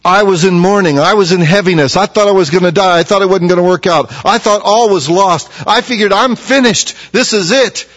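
high-pitched, urgent lament; acted despair. Preacher acts out the psalmist's hopelessness for the congregation, despairing. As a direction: cry it out as if all is lost.

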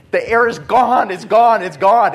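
loud, high panicked cry. Retold or imitated. Preacher replays his panic in the car over the snoring. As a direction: blurt it out as if the air is running out. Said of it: "I'm like, panicking".

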